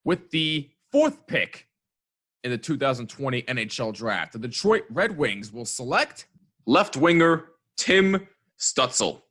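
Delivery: slow, booming podium announcement; draft announcer. Acted. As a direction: announce the pick like the commissioner. Mock draft pick announcement.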